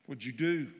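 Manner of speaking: normal talking voice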